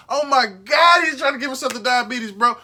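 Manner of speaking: shouting in disbelief. Real, not acted. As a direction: yell it like you cannot believe it. Yelling about salt on food.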